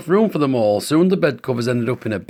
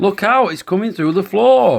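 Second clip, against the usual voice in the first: alarmed warning; 6 semitones higher, 4 dB louder than usual. A narrator's reading. Urgent warning in story.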